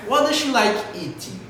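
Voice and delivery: "does she" joined, high start falling on "eating"; linked, falling intonation. Shown as a demonstration. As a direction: run "does she" together and drop "eating". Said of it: "eu juntei o does she". ("does she" junto, entonação pra baixo no "eating")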